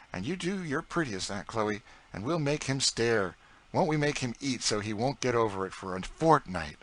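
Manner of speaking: put-on a cheeky boy egging the cook on; young George. A narrator's reading. George teasing Aunt Chloe about other cooks, boyish voice.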